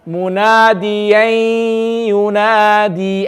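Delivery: very slow MUNADIYAN YUNADI, nasal hum held on YAN; with gunna. Shown as a demonstration. Teacher models it for everyone to repeat, held gunna. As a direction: stretch the end of munadiyan through the nose, then yunadi. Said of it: "we're going to say".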